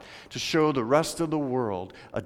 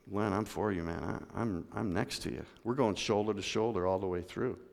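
much softer, close and sincere "I'm for you"; sincere support; 6 semitones lower, 8 dB quieter than usual. Shown as a demonstration. Pastor speaks to a front-row member, soft and sincere. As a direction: speak as if sitting right beside him. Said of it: "So, man, when I say".

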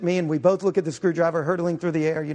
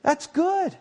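God's delight; 10 semitones higher than usual. Retold or imitated. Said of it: "God looks at the vegetation and says".

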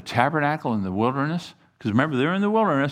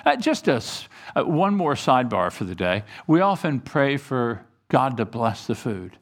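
ordinary talk, nothing marked

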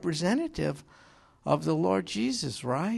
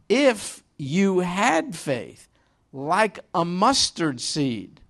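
each word drawn out slowly; slow emphatic quote; 2 semitones higher, much slower than usual. Shown as a demonstration. Preacher quoting the Lord to congregation, slow and emphatic. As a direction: read the verse slowly, weighing it. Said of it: "slow emphatic quote of verse".

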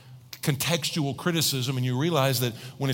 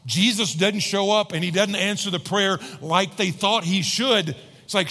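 ordinary delivery

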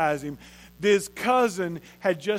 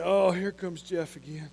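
weary sigh; 4 semitones lower, 5 dB quieter than usual. Pretend. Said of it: "he's shaking his head going".

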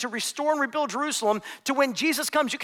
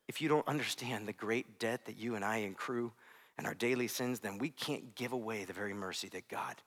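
much softer, lower, intimate sermon close; soft reflective preaching; 13 semitones lower, 12 dB quieter than usual. Acted. Pastor closing the sermon gently to the church, hushed. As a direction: draw the congregation close and quiet.

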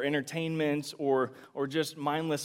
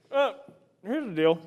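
usual speaking voice

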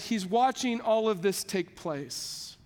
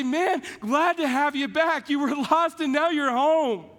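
higher, louder happy greeting with a laugh; older brother welcoming his returned brother, joyful; glad welcome; 4 semitones higher, 4 dB louder than usual. Retold or imitated. Imagined older brother welcoming sibling.

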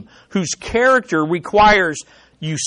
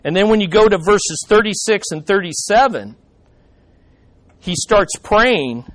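usual speaking voice

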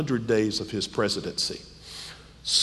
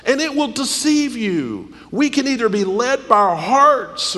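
preaching; 9 semitones higher than usual. Acted. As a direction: preach it hard from the pulpit. Preaching at full force.

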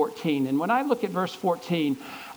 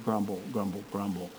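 mock grumbling mutter; 7 semitones lower, 7 dB quieter than usual. Preacher mimicking the grumbling of complainers, quieter and quieter. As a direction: lower and softer grumbling that fades away.

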